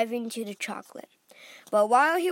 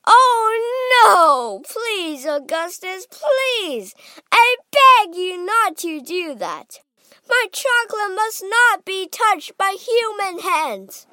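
high, loud pleading; frantic begging, voice pitched right up; crying out; 9 semitones higher, 10 dB louder, slower than usual. A narrator's reading. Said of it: "he cried out".

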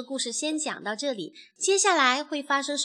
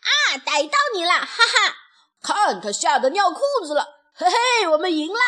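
high, loud victory crowing with ha-has; triumphant gloating; 6 semitones higher, 7 dB louder than usual. Acted. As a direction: crow with glee over a beaten foe. Chicks gloat over victory.

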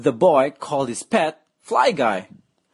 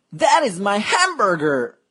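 put-on boy shouting; 6 semitones higher than usual. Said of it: "A boy shouted".

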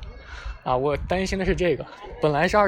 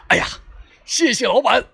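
higher, louder, booming thanks like Li Kui; booming announcer voice; 8 semitones higher, 5 dB louder than usual. Retold or imitated. Customer thanks the barber boss, booming announcer voice. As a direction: roar your thanks like a hearty Water Margin hero. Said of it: "用我的这个播音腔".

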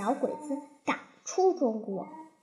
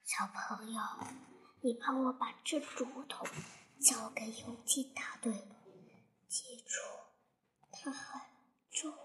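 a dying soldier's faint, far softer whisper; broken whisper; 4 semitones lower, 15 dB quieter than usual. A narrator's reading. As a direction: barely breathe the words out, halting between phrases. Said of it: "断断续续地说道". (垂死的叔叔断断续续地小声叮嘱)

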